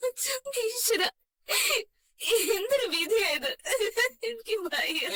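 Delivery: crying out through tears; broken-hearted wail to God; sobbing lament. Acted. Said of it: "Revathi, നീ എന്തിനാ ഇങ്ങനെ കരയുന്നേ?". (കരഞ്ഞുകൊണ്ട് വിലപിക്കുന്നു)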